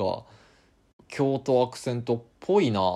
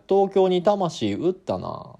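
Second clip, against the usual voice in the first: slow, much higher mock accusation; accusing friend; 9 semitones higher, 6 dB louder, slower than usual. Host voicing a friend teasing someone for selling out to Tokyo, mock accusation. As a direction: tease him for selling out to Tokyo. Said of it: "「お前、".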